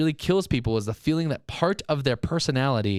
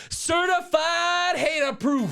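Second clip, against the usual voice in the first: high, slow, drawn-out shouted announcement; hater proof shout; 14 semitones higher, much slower than usual. Acted. Podcast host proudly announcing the hater proof segment, shouted high and slow. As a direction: belt out the segment title like a hype man. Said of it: "a very quick hater proof".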